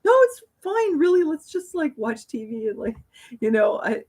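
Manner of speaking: high, loud, flustered brush-off; squeaky hurried "no, it's fine" to a friend offering to help; nervous deflecting. Retold or imitated. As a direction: nervously wave friends away from the sink. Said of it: "I'd be like".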